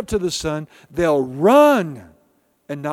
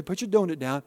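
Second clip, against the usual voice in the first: ordinary delivery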